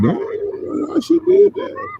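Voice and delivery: high, shocked bystander; stunned onlooker asking why; shocked reaction. Retold or imitated. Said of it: "we're gonna be like".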